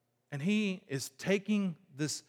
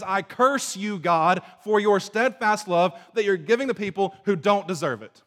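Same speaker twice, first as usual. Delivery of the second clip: louder angry cursing of God; 5 semitones higher, 8 dB louder than usual. Retold or imitated. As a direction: spit out the curse with resentment. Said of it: "he says".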